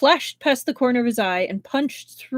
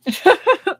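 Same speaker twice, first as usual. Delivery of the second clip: high, loud amused laugh; a brief defiant laugh; short laugh; 10 semitones higher, 7 dB louder than usual. A narrator's reading. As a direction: laugh off the threat. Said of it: "Pei Jingzi laughed".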